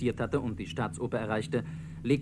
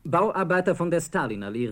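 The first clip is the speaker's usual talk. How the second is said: called out; 5 semitones higher, 4 dB louder than usual. Retold or imitated. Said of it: "rief Selbmann".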